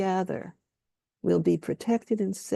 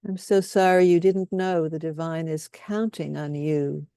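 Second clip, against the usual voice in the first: guided compassion statements. Acted. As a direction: lay each statement down gently, leaving room for echoes. Leads group in compassion statements.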